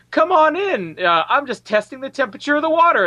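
high, inviting mock girl voice; seventeen-year-old girl. Girl inviting old man Niven into the bath, high-pitched and inviting. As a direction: lift it well up, coaxing. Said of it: "and she's like".

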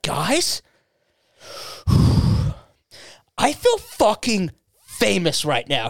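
retold giddy awe, high and slow; awestruck man-child. Storyteller voicing his friend at the festival, awestruck. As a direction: breathe out, then mimic overwhelmed wonder.

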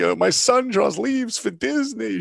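proud mother. Retold or imitated. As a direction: brag to the whole neighborhood about your son. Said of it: "She's so proud".

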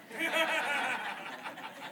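a burst of high laughter; real laugh. Real, not acted. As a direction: laugh at yourself, caught out. Laughing at his own coyness.

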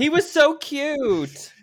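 high, drawn-out gush. Real, not acted. Co-host fondly recalls the guy with the stutter, gushing. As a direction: gush about him in a high, stretched voice. Gushing over the movie character.

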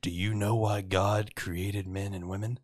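slow, measured cheesy come-on; pickup line. Retold or imitated. Man hitting on women at a bar, slow come-on. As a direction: deliver it slowly as an imitated would-be smooth talker.

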